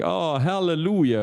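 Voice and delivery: mildly hammed-up hallelujah; mock euphoria. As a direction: imitate a gushing crowd rejoicing. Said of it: "all the usual suspects were screaming".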